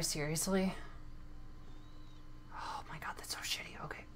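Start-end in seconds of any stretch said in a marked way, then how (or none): 2.5-4.0 whispering. Real, not acted. Frustrated gamer whispering.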